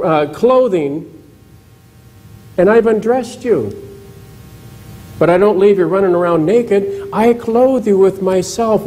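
Jesus speaking tenderly. Retold or imitated. Jesus reassuring Nicodemus, lightly voiced and gentle. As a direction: offer him life, gently and earnestly.

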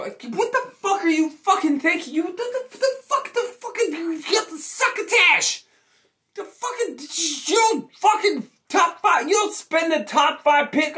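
high, cracking exasperated outburst with a laugh; incredulous rant. Real, not acted. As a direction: lose it in disbelief at the pick. Furious rant at draft pick.

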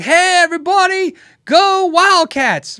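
shouting like a rowdy sports fan; loud fan. Retold or imitated. Customer bursting into the music store, rowdy fan shouting. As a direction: yell it across the store, high and slow. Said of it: "he's loud".

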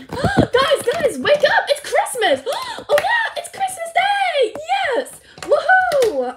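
high, loud pretend Christmas-morning excitement; excited wake-up. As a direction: play an overjoyed pup waking everyone up. Pups waking up on Christmas morning.

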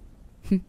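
short, scoffing little laugh; brief huff of amused laughter; amused chuckle. Acted. Vampire at human's coffin belief, amused chuckle.

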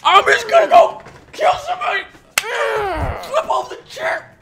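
very high, screechy ranting; mad. Real, not acted. Player raging about the teams, mad high-pitched rant. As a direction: screech the over-the-top threats. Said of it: "I'm so mad".

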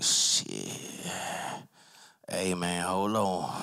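low, dazed edible voice; stoned. Acted. Man feels the edible kick in, stoned. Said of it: "Makes time slow down".

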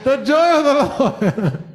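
holy laughter bubbling through the words; preacher breaks into joyful laughter mid-sermon; laughing. Real, not acted. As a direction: laugh your way into the line. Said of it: "I should start laughing like Jared".

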